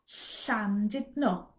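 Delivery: Llandudno slow, ll as a hissed breathy l; slow Welsh ll. Shown as a demonstration. Teacher demonstrating the Welsh double L slowly. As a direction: Llandudno with the tongue hissing air at the sides. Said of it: "I'm gonna say a series of words with L".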